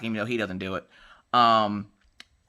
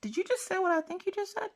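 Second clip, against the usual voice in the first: shocked disbelief; 6 dB quieter than usual. Retold or imitated. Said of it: "and I'm like".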